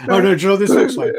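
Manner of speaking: usual speaking voice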